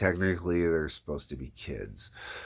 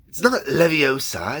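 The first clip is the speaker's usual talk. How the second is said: put-on high girlish know-it-all voice; Hermione impression; 7 semitones higher, 6 dB louder than usual. Shown as a demonstration. Demoing Hermione's key phrase to viewers, primly. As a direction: correct someone primly, like Hermione. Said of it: "for Hermione, for example".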